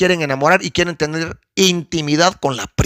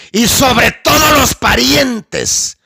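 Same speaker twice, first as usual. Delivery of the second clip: preached emphasis; 6 semitones higher, slower than usual. Real, not acted.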